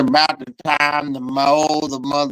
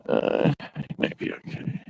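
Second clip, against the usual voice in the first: hushed, soft whisper; 11 dB quieter than usual. Real, not acted. Unsure about smoking car.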